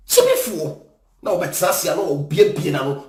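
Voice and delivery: ordinary talk, nothing marked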